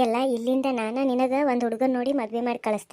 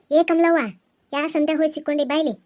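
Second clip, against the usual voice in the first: higher, louder impatient yell of her name; impatient shout; 4 semitones higher, 6 dB louder than usual. Acted. Aunt yelling again for Kamalavva, impatient shout. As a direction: shout for her now, losing patience.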